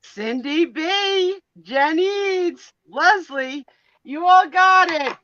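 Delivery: loud, high, excited announcing of names; calling out winners. Real, not acted. Host calling out the winners to the audience, loud and high. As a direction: shout the winners out with glee.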